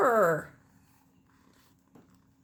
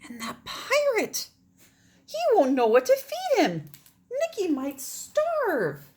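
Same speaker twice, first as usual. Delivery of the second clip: worried; 11 semitones higher, 7 dB louder than usual. Acted. Maggie worried about cricket.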